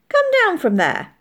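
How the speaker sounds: high, loud order; calling out. A narrator's reading. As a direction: call sharply up to the loft. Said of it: "called Molly".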